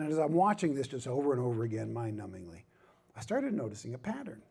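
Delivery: ordinary talk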